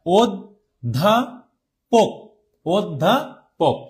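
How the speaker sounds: অধ্যাপক stretched very slowly as 'od-dapok'; slow demo. Shown as a demonstration. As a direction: draw out অধ্যাপক syllable by syllable. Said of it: "দ উচ্চারিত হচ্ছে".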